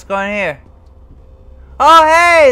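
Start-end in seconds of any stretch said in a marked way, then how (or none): 1.8-2.5 sudden loud startled yelp. Real, not acted. Gamer startled by something in the room, loud yelp. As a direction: jump at the surprise.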